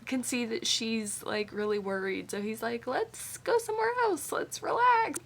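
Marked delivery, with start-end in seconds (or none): 2.9-5.2 very high, sing-out suggestion; breezy peacemaker. Retold or imitated. Gipsy steps in for a worried Shannon, high and breezy. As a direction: pitch it way up to defuse things. Voicing Gipsy easing a tense moment.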